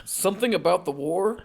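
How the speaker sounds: usual speaking voice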